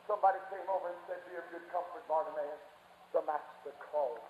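very soft, low comforting message; hushed story narration. A narrator's reading. Messenger comforts blind Bartimaeus, hushed.

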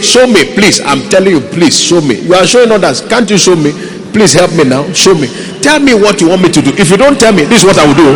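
loud, high, insistent begging at God; demanding plea. Acted. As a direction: badger God loudly for an answer. Mimicking demanding prayer to God.

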